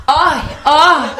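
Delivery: mock pain yelps; pain cry. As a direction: yelp like a stubbed toe. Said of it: "cuando te pegas en el dedo chiquito del pie dijeras".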